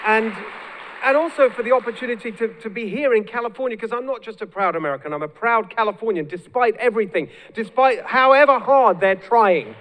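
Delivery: impassioned stump speech, swelling in volume; rallying speech. Real, not acted. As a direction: push on over the applause with pride. Speaking up over crowd's applause.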